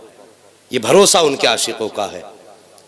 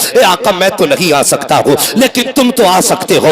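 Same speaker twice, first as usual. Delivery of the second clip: driving, emphatic sermon storytelling; fervent Urdu preacher's delivery; impassioned preaching; 2 semitones higher, 4 dB louder than usual. A narrator's reading. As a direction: preach the tale in urgent, unbroken waves. Preaches a story at full force.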